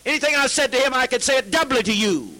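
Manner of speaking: high, loud preacher's shout; furious sermon shout. Real, not acted. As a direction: blast it out at the whole room. Shouting at the congregation.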